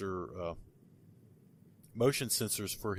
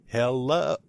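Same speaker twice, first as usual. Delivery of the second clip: woman's hello; 3 semitones higher, 5 dB louder than usual. Retold or imitated. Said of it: "you can hear, like".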